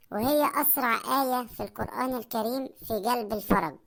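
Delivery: ordinary talk, nothing marked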